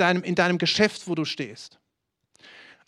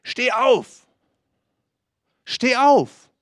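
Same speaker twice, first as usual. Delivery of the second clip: higher and louder with each call; building command; 6 semitones higher, 6 dB louder, much slower than usual. Acted. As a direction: call them to their feet ever more forcefully.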